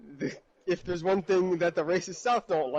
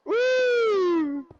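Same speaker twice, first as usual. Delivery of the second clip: drawn-out, high "woo"; sustained high holler; high, loud whoop; 6 dB louder than usual. Real, not acted. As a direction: let out one long, loud whoop. Whooping at the tense joke.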